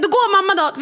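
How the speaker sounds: self-pitying mock threat. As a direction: sulk it out like a hurt kid. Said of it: "Och så säger jag". (barnsligt surt hot, högre röst)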